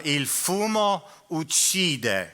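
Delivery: slow, lilting Italian reading; warning label read in sing-song Italian; sing-songy Italian. Shown as a demonstration. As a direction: let the Italian sing, slowly. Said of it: "which sounds sing-songy".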